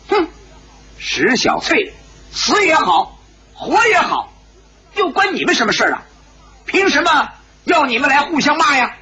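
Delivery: high, piercing female scolding; sharp woman's voice. A narrator's reading. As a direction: cut in with a shrill, high rebuke. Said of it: "一个尖锐的女子的声音说道". (尖锐的女声高声斥责)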